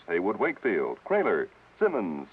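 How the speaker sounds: ordinary talk, nothing marked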